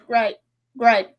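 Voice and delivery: usual speaking voice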